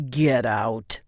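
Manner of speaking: low, through gritted teeth. A narrator's reading. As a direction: bite it off, low and tight. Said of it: "Alex spoke through his teeth".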